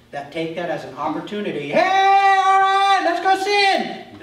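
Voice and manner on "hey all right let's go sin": high, loud goofy voice mocking careless believers; wacky sinner. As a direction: ham up a reckless fool cheering for sin. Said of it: "some really wacky people out there".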